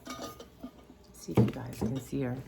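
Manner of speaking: ordinary talk, nothing marked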